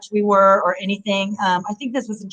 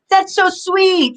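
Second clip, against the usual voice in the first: high, slow cooing over something cute; imitating an audience going aww; mock gushing crowd; 11 semitones higher, much slower than usual. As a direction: coo it like an audience melting at a sweet moment. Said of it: "everybody's gonna go".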